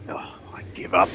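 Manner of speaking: breathy whispered give-up; muttered surrender; exasperated whisper. Acted. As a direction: sigh it out under your breath. Exasperated sigh of defeat.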